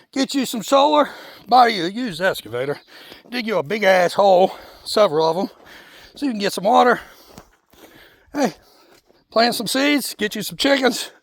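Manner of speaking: winded, breathing hard between phrases. Real, not acted. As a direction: talk while panting after a climb. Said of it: "That hill should not have got me out of breath".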